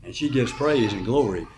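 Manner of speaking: ordinary talk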